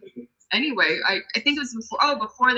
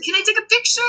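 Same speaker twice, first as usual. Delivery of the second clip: very high, imitated fangirl request; excited fan; 3 dB louder than usual. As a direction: squeak it up like a thrilled fan. Retold fan asking for photo.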